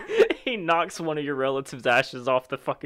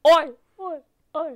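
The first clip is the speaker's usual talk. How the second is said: loud Aussie call fading like an echo; mock echo, each call fainter; echoey ghost; 13 semitones higher, 7 dB louder than usual. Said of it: "or it gets echoey".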